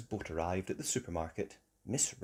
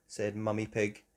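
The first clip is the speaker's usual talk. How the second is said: ordinary delivery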